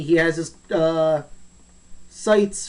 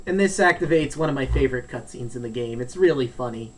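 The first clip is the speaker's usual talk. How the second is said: ordinary talk, nothing marked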